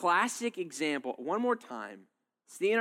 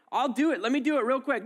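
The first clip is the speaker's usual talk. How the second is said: hasty placating; 9 semitones higher, 4 dB louder than usual. Acted. Acted spouse quarrel, placating.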